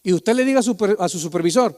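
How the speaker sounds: normal talking voice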